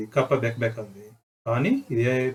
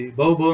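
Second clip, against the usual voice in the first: a louder, higher playful bow bow; puppy bark; 4 semitones higher, 5 dB louder, slower than usual. Retold or imitated. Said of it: "అన్నారు". (పిల్లలకు కుక్కపిల్లలా సరదాగా బౌ బౌ)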